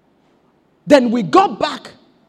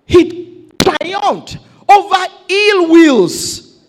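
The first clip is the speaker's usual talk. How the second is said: drawn-out, deliberate declaration; slow emphatic preaching; 4 dB louder, slower than usual. Real, not acted. Preacher to congregation, slowly declaring victory over enemies. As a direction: stretch every word for weight.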